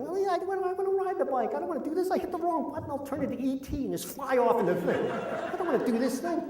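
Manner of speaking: high-pitched mock whine; whiny complaining. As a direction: whine to your wife like a reluctant kid. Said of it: "I'm complaining to my wife".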